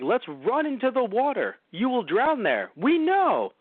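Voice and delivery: high, slow goofy dinosaur; high silly dinosaur voice. Pretend. Acted dinosaur herd dialogue.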